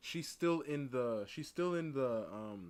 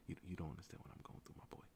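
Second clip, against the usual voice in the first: whispered, much softer, confiding; hushed aside; 7 semitones lower, 13 dB quieter, faster than usual. Pretend. Man confiding in a bystander about his girlfriend, whispered. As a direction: whisper it like a secret to the stranger.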